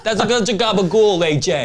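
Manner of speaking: put-on high, loud mobster voice in a New Jersey Italian accent; mock New Jersey Italian accent.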